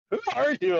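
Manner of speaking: high-pitched mock disbelief; astonished, high question; incredulous. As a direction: imitate stunned staff asking in a high voice. Staff stare at him in disbelief.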